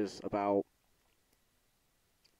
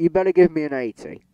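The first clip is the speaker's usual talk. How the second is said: fast, loud, higher demand; 4 semitones higher, 8 dB louder than usual. Real, not acted. Gamer telling the sim to give an eighty, fast loud demand. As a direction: order the game about.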